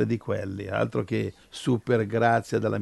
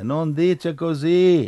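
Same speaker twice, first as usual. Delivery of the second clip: usual speaking voice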